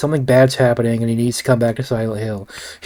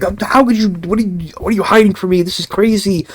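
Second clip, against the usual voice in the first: higher, hammed-up outraged demand; mock angry; 6 semitones higher than usual. James confronting Mary at home, mock angry. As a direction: play the betrayed boyfriend, mildly furious. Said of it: "he gets kind of angry at her".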